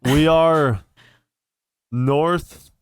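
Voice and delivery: booming call back. Acted. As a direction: shout directions across the fog. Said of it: "thaumaturgy back and say".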